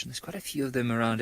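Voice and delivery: normal talking voice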